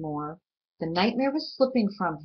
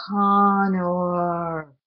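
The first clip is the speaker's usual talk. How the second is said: monster calling; 4 dB louder than usual. Acted. The monster calling Connor from the dark, drawn out. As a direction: call his name like something vast outside.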